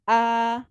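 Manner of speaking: "A" held high and level; first tone. Shown as a demonstration. Teacher shows the flat first tone to the class. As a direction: keep "A" high and flat all the way. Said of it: "Nada yang pertama".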